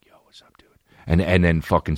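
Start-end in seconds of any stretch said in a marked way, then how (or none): 0.1-0.7 imitating a kid whispering at nap time; acted whisper. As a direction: whisper it secretly to a classmate. Classmate whispers back.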